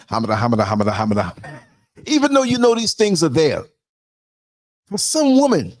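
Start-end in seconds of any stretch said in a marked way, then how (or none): 0.1-1.3 Ralph Cramden stammer. Retold or imitated. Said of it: "doing the Ralph Cramden".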